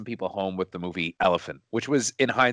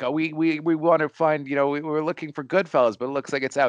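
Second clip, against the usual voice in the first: higher, louder voice of a hopeful customer; a lightly voiced customer asking for a film; imitating a customer; 8 semitones higher, 4 dB louder than usual. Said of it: "they'd be like".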